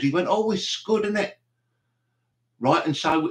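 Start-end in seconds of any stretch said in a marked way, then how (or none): 0.3-1.3 put-on Scouse accent. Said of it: "heard a Scouser referring to the missiles".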